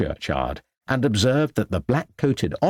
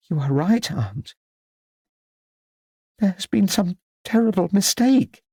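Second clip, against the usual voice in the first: halting, higher-pitched, emotional; trembling with emotion; 10 semitones higher than usual. A narrator's reading. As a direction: speak haltingly as emotion takes hold. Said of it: "her voice trembling with emotion".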